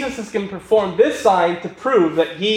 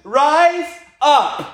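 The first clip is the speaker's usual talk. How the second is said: high, commanding call to the dead; mock funeral pastor calling someone up; pastor's summons; 6 semitones higher, much slower than usual. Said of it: "And the pastor says".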